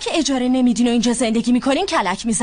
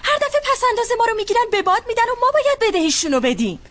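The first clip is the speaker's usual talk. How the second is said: bitter outburst; 8 semitones higher than usual. Acted. Angry complaint about uncle's debts.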